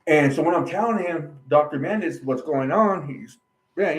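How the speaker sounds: usual speaking voice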